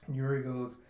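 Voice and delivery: usual speaking voice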